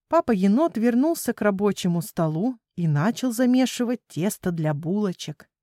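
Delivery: plain reading voice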